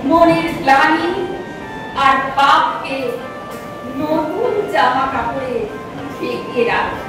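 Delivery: ordinary delivery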